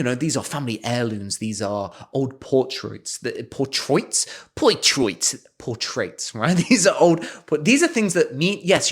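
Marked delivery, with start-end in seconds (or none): none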